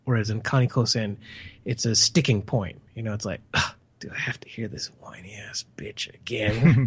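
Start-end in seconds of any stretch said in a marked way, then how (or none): 3.5-6.9 annoyed whispered aside. Retold or imitated. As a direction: mutter it under your breath, fed up. Voicing annoyed viewer's inner thought.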